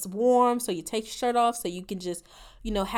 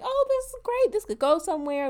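mock hopeful; 8 semitones higher than usual. Mocking her own hopeful thoughts.

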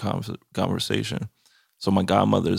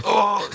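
high mock-vomiting "oh"; hammed-up heave; mock retching; 12 semitones higher than usual. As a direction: pretend to throw up, pitched high. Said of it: "I'm, like, vomiting right there".

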